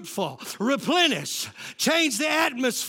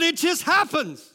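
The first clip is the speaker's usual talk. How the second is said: ordinary talk, nothing marked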